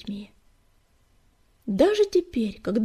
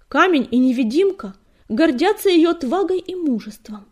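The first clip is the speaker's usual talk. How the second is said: high, loud declaration; proud proclamation; 5 semitones higher, 6 dB louder than usual. A narrator's reading. Fireheart praises leader to the clan, fervent. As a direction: proclaim her courage with fervour.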